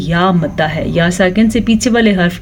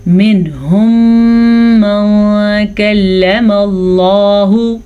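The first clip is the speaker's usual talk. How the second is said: Quran recitation; much slower than usual. Shown as a demonstration. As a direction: recite with drawn vowels. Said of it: "بغیر رکے یا بغیر غننے".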